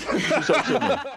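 mock-hurt whine breaking into laughter; laughing. Host laughing while feigning hurt.

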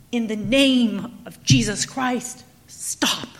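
loud, slow insistent cry; screaming. Acted. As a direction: keep crying out while chased. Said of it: "this little man is screaming".

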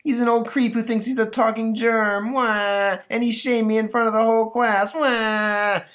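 hammed-up crybaby whine; whining kid. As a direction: wail to dad like a spoiled brat. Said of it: "we see him whining to his dad".

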